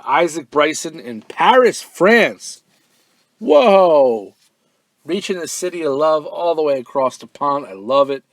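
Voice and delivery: loud, high, thrilled whoa; getting excited. Real, not acted. Host thrilled by a listener writing from Paris, loud excited. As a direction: burst with real delight at the faraway fan. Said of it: "I'm getting excited over here".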